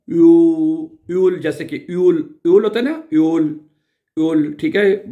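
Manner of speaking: यूल said with rounded lips; German ö. Shown as a demonstration. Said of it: "मुंह को गोल करने की बात है". (होंठ गोल करके "यूल")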